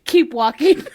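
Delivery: laughing. Real, not acted. Laughing at the NPC story.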